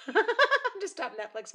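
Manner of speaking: high, laughing through the words; real laughter breaking into the sentence. Real, not acted. Cracks up while explaining interruption.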